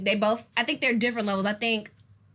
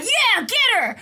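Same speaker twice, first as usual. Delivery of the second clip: high, loud egging on; cheering on the fight; egging her on; 11 semitones higher, 6 dB louder, slower than usual. Retold or imitated. Friend hyping a fight, high excited egging on. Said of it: "I'm like".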